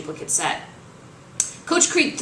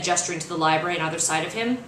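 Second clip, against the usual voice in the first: plain reading voice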